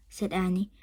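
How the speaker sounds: ordinary delivery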